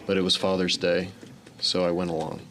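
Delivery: ordinary talk, nothing marked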